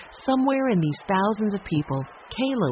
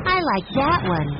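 mild shout; 7 semitones higher, 3 dB louder than usual. A narrator's reading. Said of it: "Violet shouted".

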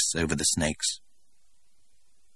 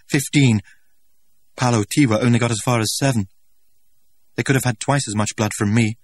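higher, louder, impassioned outpouring; anguished young man; 5 semitones higher, 5 dB louder than usual. A narrator's reading. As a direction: pour out a tortured wish. Young man's anguished speech.

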